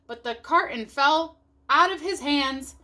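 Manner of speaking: plain reading voice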